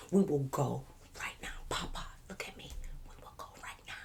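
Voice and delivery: very soft, intense whisper; whispered plea. Retold or imitated. Begging the officer to let them go, whispered. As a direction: whisper it, fading to almost nothing.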